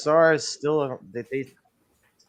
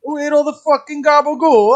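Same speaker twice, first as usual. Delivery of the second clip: hammed-up Italian-American New York shout; Bronx accent; 11 semitones higher, 9 dB louder than usual. Carrying on the Bronx routine for laughs, mock-outraged Bronx accent.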